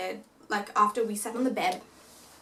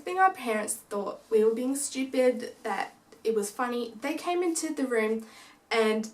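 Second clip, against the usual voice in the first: normal talking voice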